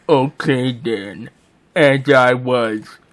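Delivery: slow, droopy cartoon narrator; Droopy Dog. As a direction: drag the words out like a droopy hound. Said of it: "narrator is now Droopy Dog".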